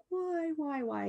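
soft, high, drawn-out plea; imitated crying. Mother retelling her youngest daughter's reaction, imitated crying. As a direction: imitate a child's tearful wail, softly. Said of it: "she's just crying".

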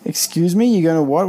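high, loud incredulous question; indignant disbelief. Acted. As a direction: play it outraged, voice jumping up. Acted indignant reaction to the threat.

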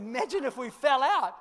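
high, laughing re-quote; repeated through laughter. Retold or imitated. Pastor repeating his son's words, laughing. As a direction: say it again, chuckling in disbelief. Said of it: "Literally what he said".

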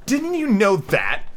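high, drawn-out taunting question; crying out. A narrator's reading. Second Daisy chiming in at Alice, high slow cry. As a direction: stretch it out high. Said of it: "cried another Daisy".

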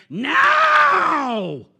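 long, high-pitched wild shout; hammed-up horror-movie scream; crazed old man's yell. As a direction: bellow it like a crazed old man, drawn out. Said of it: "looks at me with these crazy eyes".

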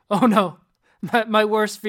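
half-laughing mock alarm; mock panic. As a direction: act out a brain short-circuiting mid-demo. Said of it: "your brain just short circuits and you're like".

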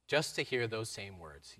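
ordinary talk, nothing marked

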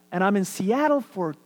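normal talking voice

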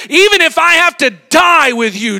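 loud, high, fired-up promise; bold declaration. Retold or imitated. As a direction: swear it with your chest out. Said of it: "Peter declares".

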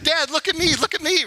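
put-on high kid voice begging for attention; squeaky little-kid call; childlike plea. Child pleading for dad's attention.

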